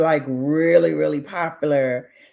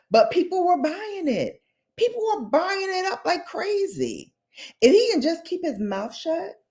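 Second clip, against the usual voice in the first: exasperated high; 12 semitones higher than usual. Real, not acted. High-pitched exasperated commentary.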